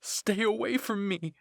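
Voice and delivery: loud, high, drawn-out scream; acted terrified shout. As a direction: scream it in terror to drive them off. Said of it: "I screamed through my muzzle".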